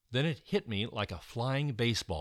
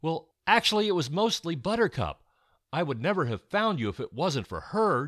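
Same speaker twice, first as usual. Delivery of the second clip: excited reply; 6 semitones higher, 5 dB louder than usual. A narrator's reading. Modest reply after rescue.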